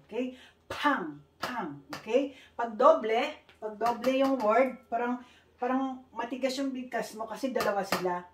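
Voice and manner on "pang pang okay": ordinary delivery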